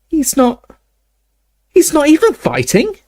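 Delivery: put-on high, indignant girl; high-pitched indignant protest; young girl voice. Hermione indignant, voiced higher.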